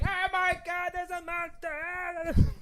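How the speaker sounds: high, slow hammed-up shriek; mock panicked scream. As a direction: stretch out a terrified cry about the monster. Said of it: "He's just like".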